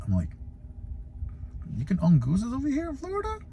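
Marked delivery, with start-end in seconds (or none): 1.8-3.4 squeaky high, fast disbelieving question; can't believe geese are pets here; high squeaky astonishment; incredulous squeak. Retold or imitated. Stunned by neighbor's pet goose.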